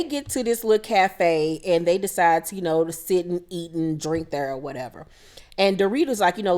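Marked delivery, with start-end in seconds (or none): none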